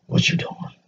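hushed, furtive whisper; very soft, secretive question; mock whisper. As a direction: whisper it low, as if sneaking back. Acts out sneaking back next day.

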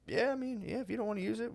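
high, soft, placating; mock meek agreement. Man answering about the word, high mock-meek agreement. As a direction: imitate backing down in a small high voice.

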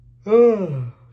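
big drawn-out yawn; loud yawn. Real, not acted. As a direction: yawn out loud, long and high. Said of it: "Sorry, I don't mean to yawn".

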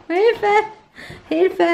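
high playful Hilfe; mock cry for help. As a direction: pretend to call for help.

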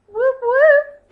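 a big happy cheer for the ladies; excited cheer. Real, not acted. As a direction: whoop it out high and loud. Cheering for the gift senders.

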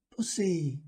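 put-on high small child's voice; child calling. Child calls to rabbit.

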